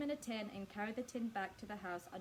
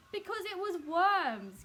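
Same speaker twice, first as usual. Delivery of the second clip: slow, loud gloating shout, higher; triumphant cry; 8 semitones higher, 10 dB louder, slower than usual. A narrator's reading. Mrs Twit gloats to her husband about the worms, loud and slow. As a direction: crow it out in triumph. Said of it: "cried Missus Twit".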